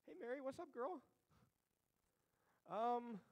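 high, casual chatty angel; hammed-up high breezy angel. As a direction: announce a miracle like it's gossip. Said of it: "an angel appears to the Lord and says".

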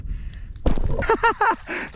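a quick breathy laugh at the catch; surprised laugh. Real, not acted. Angler laughing at a fish hitting the lure right away.

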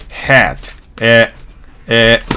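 ヘッ ド as hat, then え as a bare 'æ'; American A. Shown as a demonstration. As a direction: hold え between 'ah' and 'eh'. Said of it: "あとへの中間なんですね".

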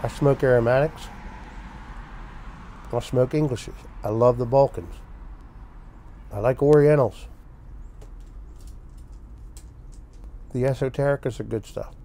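speaking with pipe in mouth; talking around a pipe. Real, not acted. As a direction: pipe clenched in teeth. Seen 4: pipe stem clenched in teeth throughout; absent in reference frames.

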